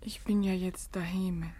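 faint, exhausted whisper; barely able to speak; drained whisper; weak whisper. Acted. Exhausted Rose sends him away.

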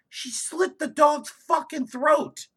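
ordinary talk, nothing marked

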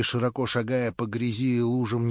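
ordinary delivery, nothing marked

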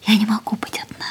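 hushed whispered confession. Acted. Lilian confessing to him she cannot be alone, whispering. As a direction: barely voice it, intimate.

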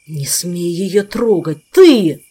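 slow, then a powerless yell; helpless rage, shouting the last word; furious shout. A narrator's reading. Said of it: "В бессильной ярости прокричал".

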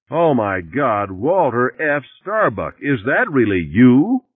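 high, drawn-out cry of astonishment; piercing shopping bag lady. A narrator's reading. Shopping bag lady recognizes him, piercing outcry. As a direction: cry it out slowly, climbing to a loud finish. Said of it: "whose voice was loud and piercing, cried out".

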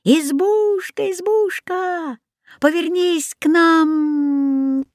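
high, slow drawn-out chant; calling out. A narrator's reading. Boy asks the hut to turn round, calling out. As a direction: call to the hut in long singsong vowels. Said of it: "он закричал".

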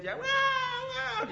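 very high, slow imitated animal howl; preacher mimics the trapped fox crying out, high and drawn out; fox yelping. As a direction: yelp like a trapped fox in the night. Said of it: "Ya estaba gritando el zorro".

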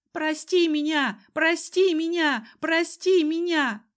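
high, repetitive plea; pleading babble. A narrator's reading. Cousin lies on the ground babbling pleas for forgiveness. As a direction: repeat it as a high, helpless whimpered plea. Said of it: "и бормотал".

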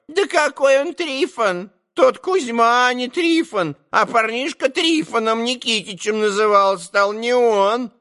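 put-on high, squeaky old trader woman; old market woman. A narrator's reading. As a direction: chatter it up high like a gossip.

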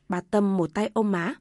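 plain reading voice

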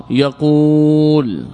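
يقول with the uu held long and level; long original vowel. Shown as a demonstration. Teacher showing the original form, drawn-out يقول. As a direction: stretch the uu of يقول slowly. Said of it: "حينئذ الأصل".

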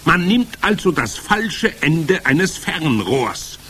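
high, slow, loud witch voice; instructing Grand High Witch. A narrator's reading. Witch leader dictating her recipe, commanding.

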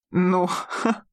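short derisive snort; scoffing huff. A narrator's reading. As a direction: huff it scornfully. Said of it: "Король хмыкнул".

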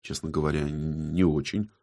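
low, quiet grumble; soft, low, dragged-out grumbled reply; grumbling. A narrator's reading. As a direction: grumble it reluctantly under your breath. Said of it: "буркнул букинист".

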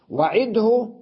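وعده as wa-'id-hu; original full form. Shown as a demonstration. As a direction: still د, voiced hu in وعده. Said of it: "ماذا أقول".

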